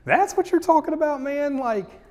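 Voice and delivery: high-pitched, disbelieving complaint; incredulous grumbling. Acted. Grumblers balking at Jesus' bread from heaven claim, incredulous. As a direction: push it up high like a baffled heckler.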